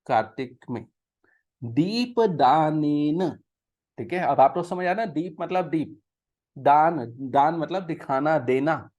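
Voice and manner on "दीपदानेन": very slow, word by word Sanskrit recitation; slow scripture reading. Shown as a demonstration. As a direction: read the verse out slowly and carefully. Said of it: "पढ़ो". (धीमा संस्कृत पाठ)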